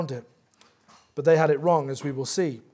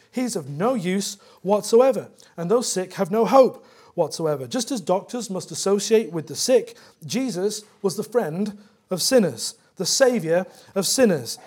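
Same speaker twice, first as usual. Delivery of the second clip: rising sermon passion; 3 semitones higher, 3 dB louder than usual. Acted. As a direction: drive the message home from the pulpit. Preaching builds to full force.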